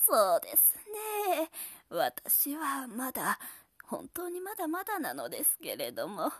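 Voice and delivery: put-on slow old woman voice, easing back; lightly voiced old lady, slow start. Shown as a demonstration. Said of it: "老婆であったら". (老婆の声)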